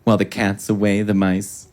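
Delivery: low, measured hostess voice, pleased with herself; Barefoot Contessa. Retold or imitated. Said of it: "she was giving the intro and she goes".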